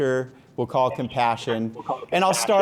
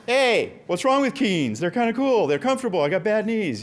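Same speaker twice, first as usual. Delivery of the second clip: higher-pitched, playful self-justifying protest; mock defensive; 7 semitones higher than usual. Father answering daughter's sound about his clothing, mock defensive. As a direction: plead your case in mock indignation.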